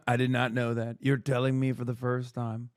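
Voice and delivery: mild mock innocence; mock innocent denial. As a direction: play dumb as if hearing it first. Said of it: "He did the Trump".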